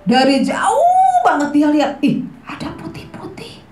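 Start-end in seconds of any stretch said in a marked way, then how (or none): 2.0-3.6 hushed, unvoiced whisper; kids' story spooky whisper; scared whisper. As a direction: whisper as if spotting a ghost.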